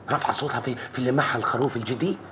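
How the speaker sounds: usual speaking voice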